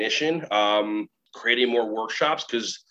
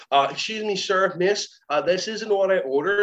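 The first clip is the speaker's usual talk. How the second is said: higher-pitched hesitant customer; mock-polite objection, pitched up; polite complaint; 6 semitones higher than usual. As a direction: play a diner flagging down the waiter. Diner complaining to waiter, acted.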